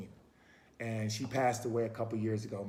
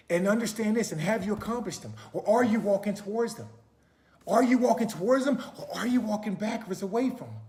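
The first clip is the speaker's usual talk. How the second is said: loud, high, driving preacher voice; forceful preaching; 7 semitones higher, 6 dB louder than usual. Acted. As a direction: preach it out to the crowd.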